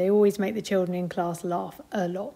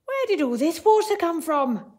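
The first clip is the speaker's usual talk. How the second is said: raised, louder bellow of a puzzled man; 7 semitones higher, 5 dB louder than usual. A narrator's reading. Teacher reading to class, Mister Archimedes bellowing. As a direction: bellow it out, high and loud. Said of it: "bellowed Mister Archimedes".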